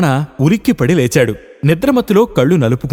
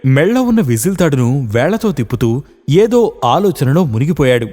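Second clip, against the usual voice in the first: ordinary delivery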